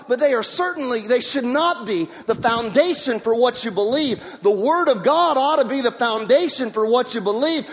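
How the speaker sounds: ordinary delivery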